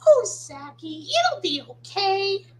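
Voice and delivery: high, sweet little voice; comforting cartoon character. Comforting hurt friend in the impression, high character voice. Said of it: "why don't we do a quick impression".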